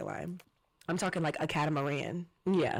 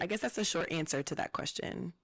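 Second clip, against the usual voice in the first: ordinary talk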